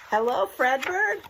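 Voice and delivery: high, loud cheerful hello; team greeting. Acted. Team after the anthem greets Fredbird, loud cheer. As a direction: call out together like a team. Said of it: "the team said".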